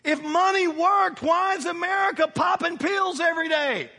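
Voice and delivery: loud, building sermon cry; fiery pulpit shout; preaching at full force. Real, not acted. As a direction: preach it to the back pew.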